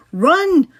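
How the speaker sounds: high, loud yell; shouting over wind. A narrator's reading. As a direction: call it over the storm.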